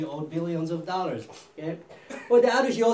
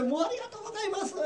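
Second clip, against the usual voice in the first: very high, rapid acted gratitude; overjoyed thanks; 14 semitones higher, 5 dB quieter, much faster than usual. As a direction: squeal out mock relieved thanks. Forgiven man thanks king, overjoyed.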